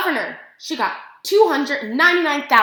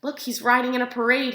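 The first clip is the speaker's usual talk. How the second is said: ordinary delivery, nothing marked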